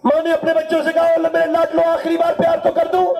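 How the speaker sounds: high, fervent sermon voice of a mother's farewell; impassioned preaching. Acted. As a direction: preach the mother's last love.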